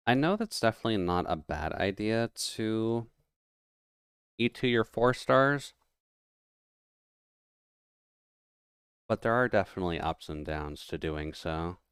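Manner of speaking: talking around a pen cap; words muffled by a pen in mouth; chewing pen cap. Real, not acted. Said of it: "I should stop chewing on my pen".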